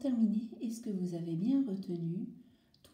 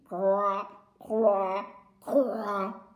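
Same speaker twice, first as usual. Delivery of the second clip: crows cawing; 4 dB louder, much slower than usual. Acted. Les corbeaux croassent.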